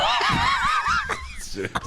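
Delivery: high shrieking laugh; a squealing cackle of disbelief. Real, not acted. Reacting to explicit reading.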